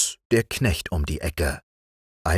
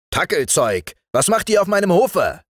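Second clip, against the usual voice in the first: raised, loud challenge; gruffly demanding, climbing higher; indignant forester; 11 semitones higher, 4 dB louder than usual. Acted. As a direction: confront intruders on your own yard. Forester angrily confronts the soldiers.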